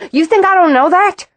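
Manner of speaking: high, loud outraged yell; furious shout. A narrator's reading. Roz shouting at Haley, high and loud with fury. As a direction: blast it back at her. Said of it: "The shout, the fury in it".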